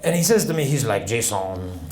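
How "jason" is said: put-on low French-accented address. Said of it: "there's a priest visiting from France".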